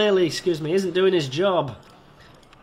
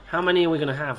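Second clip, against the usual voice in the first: ordinary talk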